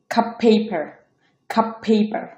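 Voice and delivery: cup paper, the t of "cut" turned to p; assimilated T. Shown as a demonstration. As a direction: hold one long p across cup paper. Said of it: "এটা উচ্চারণ হবে".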